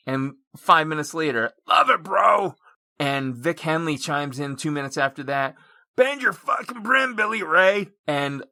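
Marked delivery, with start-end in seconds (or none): 1.7-2.5 loud, high imitation of a hyped-up friend; enthusiastic shout. Acts out friend's enthusiastic online reply. 6.0-7.9 high, loud mock heckle; hammed-up yelled jibe; Vic Henley. Acts out Vic Henley's heckling reply.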